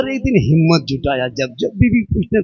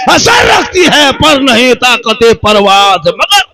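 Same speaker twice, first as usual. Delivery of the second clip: impassioned sermon climax, much higher and louder; preaching at full force; 10 semitones higher, 5 dB louder than usual. Acted. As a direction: cry out the poor man's plea at the shrine.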